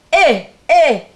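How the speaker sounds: big Hawaiian man shouting orders from the ship; loud booming voice. Retold or imitated. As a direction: boom it down from the deck at the boys. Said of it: "In a really loud voice".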